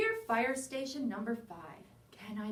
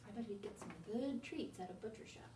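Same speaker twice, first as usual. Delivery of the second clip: whispering; 2 semitones lower, 9 dB quieter than usual. Real, not acted. Listeners whisper aside.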